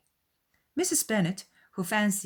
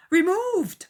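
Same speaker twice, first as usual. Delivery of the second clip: high, loud exclamation; cried out; 8 dB louder than usual. A narrator's reading. Bingley protesting Jane's removal, loud and high. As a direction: burst out in shocked protest. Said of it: "cried Bingley".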